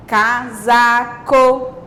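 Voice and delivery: "casaco" very slowly, CA, SA, CO; divided into syllables. Shown as a demonstration. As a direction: sound out "casaco" in three level, separate beats. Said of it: "as palavras em sílabas". ("casaco" bem devagar, sílaba por sílaba)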